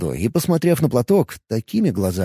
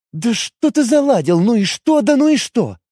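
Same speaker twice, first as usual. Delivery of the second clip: irritated, higher elf; flaring up; 9 semitones higher than usual. Acted. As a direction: snap back, losing patience. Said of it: "вспылила".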